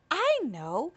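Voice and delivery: ordinary delivery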